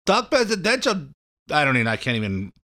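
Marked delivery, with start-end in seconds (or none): 0.0-1.1 weak Slovenian accent attempt; mock Slovenian accent. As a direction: Talk presidential in a light, higher Slovenian accent. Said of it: "so she probably says".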